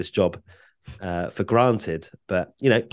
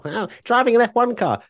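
excited; 5 dB louder than usual. Pretend. Mocks own stock answer.

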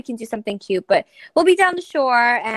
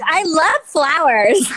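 gushing flower lover; 4 semitones higher, 4 dB louder, much slower than usual. Pretend. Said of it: "Be like".

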